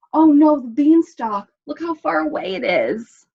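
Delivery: loud, high dismay. A narrator's reading. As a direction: panic at the distance. Cat dismayed.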